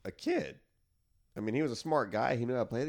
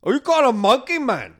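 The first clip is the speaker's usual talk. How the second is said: put-on loud, high Cuban gangster accent bark; shouted Scarface accent; 14 semitones higher, 14 dB louder than usual. Quoting Scarface, a loud hammed-up Cuban accent. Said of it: "I'm doing a shitty accent".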